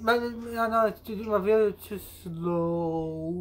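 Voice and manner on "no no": ordinary talk, nothing marked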